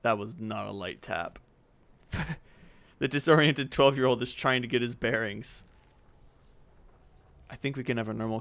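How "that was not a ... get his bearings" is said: usual speaking voice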